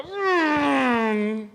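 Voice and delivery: high, loud "oh no"; dismayed exclamation. Acted. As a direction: cry out in dismay. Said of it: "he exclaimed".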